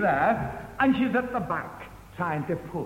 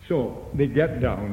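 normal talking voice

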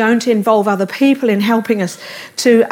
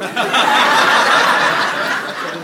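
breathy laugh. Real, not acted. Laughs at Paul joke.

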